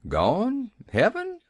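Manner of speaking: high, baffled echo of the words; stunned repeat. Acted. Old Tom echoes Nancy in dazed disbelief. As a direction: repeat it dumbly, rising sharply. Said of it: "he repeated stupidly".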